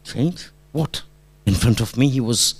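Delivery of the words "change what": soft, rising "Change?" as a question; puzzled echo. Retold or imitated. Teacher hearing the wife's hint, puzzled questioning of "Change". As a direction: echo "Change" back softly, baffled.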